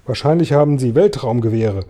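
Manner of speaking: ordinary delivery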